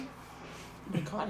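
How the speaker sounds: breathless whisper, very soft; hushed oh-my-God whisper; whispered panic. Retold or imitated. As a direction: mouth it in horror, voice gone. Panicking as dad catches her.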